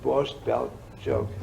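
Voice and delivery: normal talking voice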